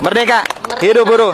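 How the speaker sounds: fiery revolutionary speech. Real, not acted. Rally chant closing a speech.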